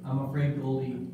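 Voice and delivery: low, soft, grave news; mock solemn. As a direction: deliver it gravely and gently. Pastor delivers bad news.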